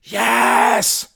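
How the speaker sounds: high, loud roar of assent; scream. Acted. Crowd answering Mary as one, high scream. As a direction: yell it as a whole crowd. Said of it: "screamed the crowd".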